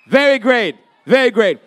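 ordinary delivery

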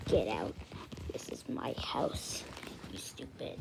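low, whispered demonic threat; possessed voice. Acted. Possessed Guru warning the kids off, low whisper. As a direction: breathe it like a possessed ghost. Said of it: "I think Guru is possessed".

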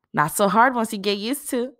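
bright chatter to a new friend; Aisha, chatty. Acted. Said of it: "Aisha was saying".

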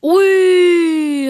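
sharp loud cry of surprise; pained shout. Real, not acted. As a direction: yelp out in shock. Sudden cry at game hit.

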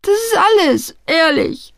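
put-on whimpering young man protesting his innocence; wailing. A narrator's reading. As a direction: plead in a pitiful high wail. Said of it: "jammerte er".

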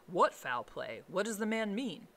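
mildly alarmed with a touch of irritation; frightened, irritated. A narrator's reading. Lawyer questioning the fearful butler, frightened and irritated. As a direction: demand an answer, rattled and a bit cross. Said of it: "frightened and rather inclined to be irritated".

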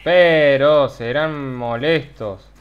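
slow, loud drawn-out complaint; slow annoyed. Real, not acted. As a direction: drag it out in annoyance.

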